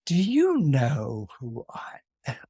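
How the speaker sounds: slow, whispery menace; ominous tone. Acted. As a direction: sink into a slow, threatening whisper. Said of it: "from that tone it's not good".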